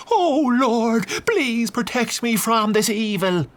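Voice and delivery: the frightened priest begs protection; terrified priest praying aloud; fearful prayer. A narrator's reading. Priest prays, backing away.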